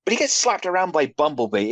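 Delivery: ordinary delivery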